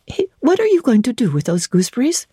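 ordinary delivery, nothing marked

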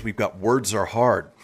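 usual speaking voice

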